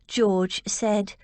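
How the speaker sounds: ordinary delivery, nothing marked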